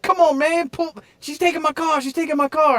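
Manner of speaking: very high, loud panicked pleading; man pleading as his car is taken, high and urgent; desperate plea. Retold or imitated. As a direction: beg frantically as she drives off. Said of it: "He's like".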